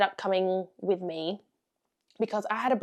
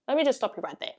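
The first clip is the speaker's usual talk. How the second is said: ordinary delivery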